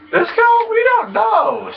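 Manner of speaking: very high squeal; squeaky squeal. Pretend. Gamer mocking a pedestrian, very high squeal. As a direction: squeak it out in a falsetto. Sudden squeal mid-rant.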